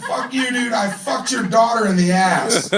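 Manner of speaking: laughing mock yell; mock yell back. Yelling a comeback at the coworker, laughing mock shout.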